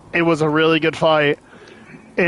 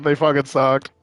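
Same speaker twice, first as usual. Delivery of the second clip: ordinary talk, nothing marked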